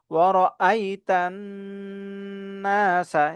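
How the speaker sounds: long nasal n joining "ওয়ারআইতান নাসা"; measured "ওয়ারআইতান নাসা" with a sustained nasal n; held ghunnah. Shown as a demonstration. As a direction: hum on the n before "নাসা". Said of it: "ওয়াজিব গুণা আছে".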